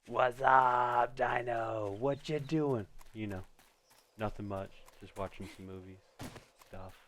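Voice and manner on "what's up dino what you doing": loud, drawn-out "What's up"; loud "What's up, Dino" shout; Budweiser ad voice. Acted. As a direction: belt it like the eighties beer ad. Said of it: "Budweiser eighties commercial".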